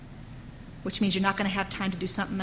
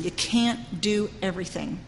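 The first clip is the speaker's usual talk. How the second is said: ordinary talk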